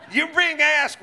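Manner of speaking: ordinary talk, nothing marked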